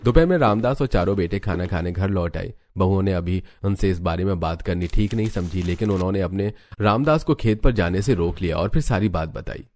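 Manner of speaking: usual speaking voice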